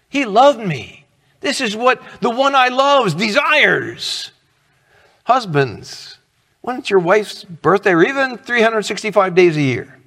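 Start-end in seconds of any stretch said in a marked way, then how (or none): 0.1-4.3 high-pitched, fervent declaration; fervent believer. Acted. Preacher voicing a loved believer, fervent and high. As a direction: lift the voice in ardent conviction. Voices a believer who loves God.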